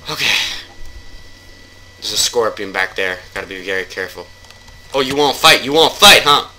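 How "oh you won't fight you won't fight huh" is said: loud, higher-pitched goading, second time louder; mock taunting challenge. Player squaring up to a game enemy, mock taunting challenge. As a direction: challenge the enemy with playful bluster.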